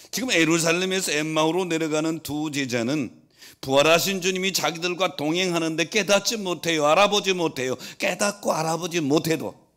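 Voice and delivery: loud, high, insistent sermon voice; full-force preaching. Real, not acted. Preacher telling of two disciples to Emmaus, full-force preaching. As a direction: hammer the point home at full volume.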